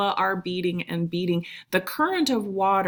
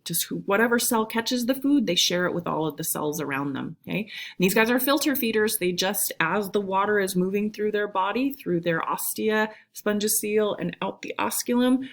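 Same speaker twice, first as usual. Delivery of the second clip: ordinary talk, nothing marked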